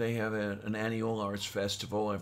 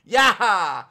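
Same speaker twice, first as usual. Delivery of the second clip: excited; 8 semitones higher, 13 dB louder than usual. Real, not acted. Cheering after banter.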